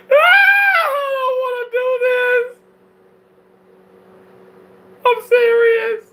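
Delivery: high, loud hammed-up sob; fake crying. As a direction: wail it like a fake breakdown. Mock crying about living in car.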